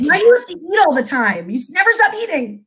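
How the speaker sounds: high, exasperated mock scolding; mock nagging parent. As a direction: rattle off a playful complaint at the kids. Mock scolding of her kids.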